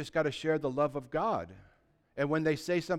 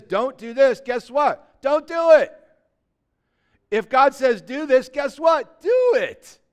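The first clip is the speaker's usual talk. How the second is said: louder, higher, building to a shout; full-force preaching; 5 semitones higher, 9 dB louder than usual. Real, not acted. Pastor drives home obeying God, building to a shout. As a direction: preach it hard and climb to a cry.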